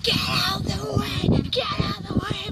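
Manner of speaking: loud, high impatient yelling; retold road-rage shout from the car; mock shout. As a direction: yell at her to move. Shouting at old woman crossing.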